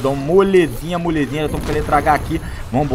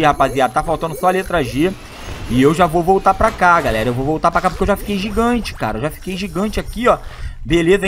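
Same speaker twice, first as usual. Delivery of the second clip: normal talking voice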